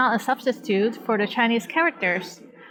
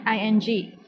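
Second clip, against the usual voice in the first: ordinary delivery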